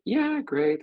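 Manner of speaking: squeaky high, mock flat thanks; hammed-up brush-off; curt client.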